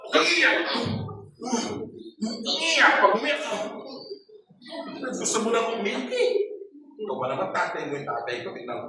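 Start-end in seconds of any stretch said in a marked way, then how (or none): 0.1-6.3 higher, louder retold yell of "umiyak"; angry parent yell. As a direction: bark it like a strict parent at the kid. Parent yelling at crying child.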